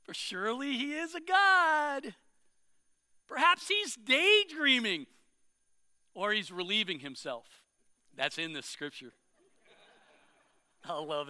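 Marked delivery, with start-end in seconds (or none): 0.0-7.6 mock their god with a grin. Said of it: "Elijah began mocking them".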